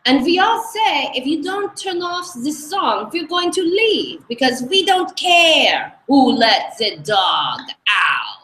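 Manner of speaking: put-on retelling an exercise-class complaint in a Hungarian accent; Aggie's Hungarian accent. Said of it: "one more quick Aggie impression".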